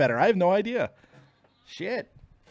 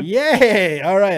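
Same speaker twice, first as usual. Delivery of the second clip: excited; 4 semitones higher, 9 dB louder, much slower than usual. Real, not acted. Relieved connection works again.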